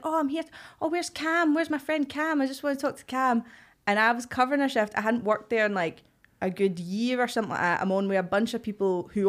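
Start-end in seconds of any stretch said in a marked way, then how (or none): 0.0-3.4 higher, eager voice; mock cheery regular. Barmaid imitating the regular asking for Cam, higher and eager. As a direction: wheedle like a friendly drunk. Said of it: "He's like".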